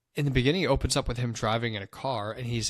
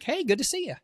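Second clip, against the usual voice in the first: high, put-on creepy friendly voice; sudden high mock-friendly hello; creepy greeting. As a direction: greet him like an unsettling stranger. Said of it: "I was like".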